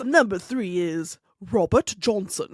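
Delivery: slow, low mock actor delivery; imitating the actor. Co-host quoting the clip, imitating the actor. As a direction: drop low and intone it slowly. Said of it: "First of all, he says".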